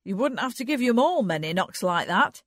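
bantering country character voice; teasing. A narrator's reading. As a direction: rib someone cheerfully, voice lifted. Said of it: "he teased".

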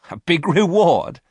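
high, loud, mocking echo; amused scoff. A narrator's reading. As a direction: throw it back as if amused. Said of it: "said Varon with a laugh".

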